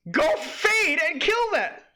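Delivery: high, slow, drawn-out yell; exasperated shout. Real, not acted. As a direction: holler the order in disbelief. Urging the kill, exasperated.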